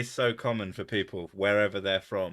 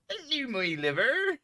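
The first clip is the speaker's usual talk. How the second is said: high-pitched comic Cornish lady; Cornish accent; 3 dB louder than usual. Retold or imitated. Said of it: "I could do a sort of Cornish accent".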